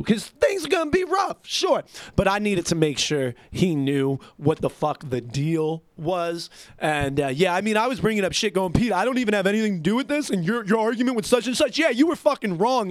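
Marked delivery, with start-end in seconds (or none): 0.0-1.8 high-pitched concession. Real, not acted. Conceding hard times after quitting. 8.7-12.9 fast, high-pitched indignant rush; retold argument. Worker back inside telling Pete he's wrong, indignant.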